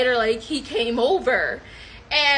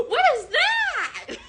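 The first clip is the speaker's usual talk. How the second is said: gasp, then high drawn-out squeal; mock shock; slower than usual. Sheltered woman startled by him, shocked squeal. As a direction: gasp and squeal in acted astonishment. Acts out her shocked reaction.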